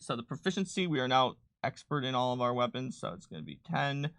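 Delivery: ordinary delivery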